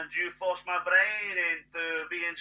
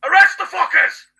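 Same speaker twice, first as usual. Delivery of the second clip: furious shout; 13 semitones higher, 16 dB louder than usual. Real, not acted. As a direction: yell it at the top of your lungs, much higher. Ranting at the authorities.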